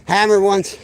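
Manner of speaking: loud. Real, not acted. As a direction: call it out loud and high. Announcing dog's claim to spot.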